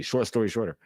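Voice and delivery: ordinary talk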